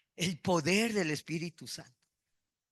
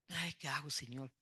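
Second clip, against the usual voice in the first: mock lament; 9 dB quieter than usual. Mock worried believer.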